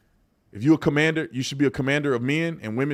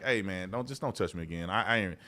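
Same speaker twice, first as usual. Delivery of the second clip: much softer, low-key brush-off; soft calm warning; 6 semitones lower, 8 dB quieter than usual. Retold or imitated. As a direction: quietly tell a coworker to back off. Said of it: "I'd be like".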